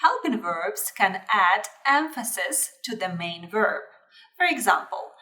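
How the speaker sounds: usual speaking voice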